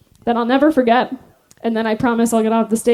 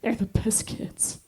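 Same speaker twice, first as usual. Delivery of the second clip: whispered, slower; 3 semitones lower, 16 dB quieter, slower than usual. Retold or imitated. Instructor whispering about the kids to the recruits. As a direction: confide it in a hush. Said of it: "and then she goes".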